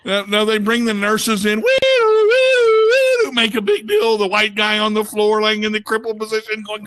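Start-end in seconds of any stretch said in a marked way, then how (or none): 1.6-3.3 high, loud wailing siren; siren imitation. Nurses rushed in to the man on the floor, siren wail.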